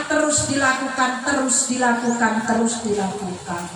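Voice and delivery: fading, falling refrain; sinking repetition. Real, not acted. Endless act, repeated with sinking voice.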